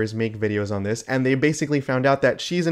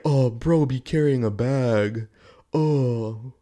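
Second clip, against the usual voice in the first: mock impression; much slower than usual. Mocking impression of her content.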